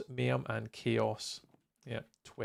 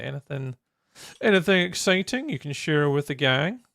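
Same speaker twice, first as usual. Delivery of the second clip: ordinary talk, nothing marked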